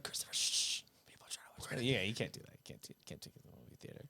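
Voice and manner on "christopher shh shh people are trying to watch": whispered shushing; whispered hush. Retold or imitated. Shushing brother in the theater, whispered. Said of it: "it's like".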